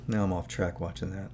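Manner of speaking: usual speaking voice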